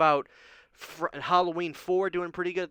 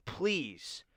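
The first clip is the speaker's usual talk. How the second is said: ordinary delivery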